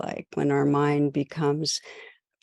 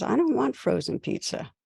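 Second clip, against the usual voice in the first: put-on higher child's voice, whining "I don't want frozen pizza."; a small boy refusing dinner, pitched up; son's protest; 8 semitones higher than usual. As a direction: son pushing back on the frozen pizza. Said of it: "He says".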